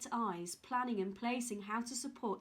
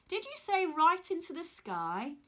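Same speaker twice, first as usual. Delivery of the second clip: amazed question; 6 semitones higher, 5 dB louder than usual. Acted. Jack amazed.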